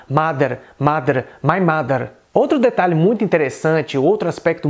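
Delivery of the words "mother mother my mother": "mother" with D for TH and tapped R; Indian English accent. Shown as a demonstration. Teacher contrasting with American, Indian accent. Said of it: "e no inglês indiano".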